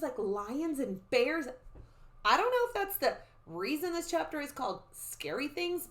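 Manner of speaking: ordinary delivery, nothing marked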